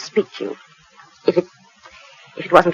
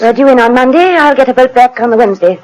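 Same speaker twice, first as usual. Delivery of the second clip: angry wife; 6 semitones higher, 6 dB louder than usual. Acted. Wife announcing she is leaving.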